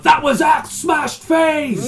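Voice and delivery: high, loud, slow stage scream; metal frontman shout. Acted. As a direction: bellow it like a metal singer to the crowd. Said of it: "they were like".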